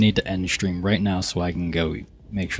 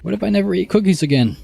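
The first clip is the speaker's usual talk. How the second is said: high-pitched dramatic fretting; mock alarm; 12 semitones higher, 7 dB louder than usual. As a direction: wail it as a hammed-up worry. Acts a character's cookie worry.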